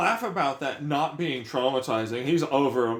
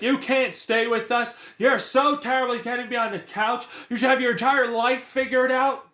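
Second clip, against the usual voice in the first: sister parodied much higher and louder; hammed-up nagging, pitched way up; mock sister voice; 11 semitones higher, 4 dB louder than usual. Ranter mimics younger sister scolding her, high mocking parody.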